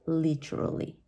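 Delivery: literally keeping a true T; British pronunciation. Shown as a demonstration. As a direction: say "literally" as LIT-er-uh-lee. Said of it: "the letter T stays".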